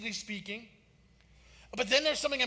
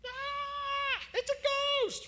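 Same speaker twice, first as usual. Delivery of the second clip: put-on a much higher squeaky boyish shriek; prepubescent shriek; much slower than usual. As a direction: squeal like a frightened middle school boy. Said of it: "cry out like middle school boys pre-pubescent".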